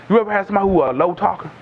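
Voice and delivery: normal talking voice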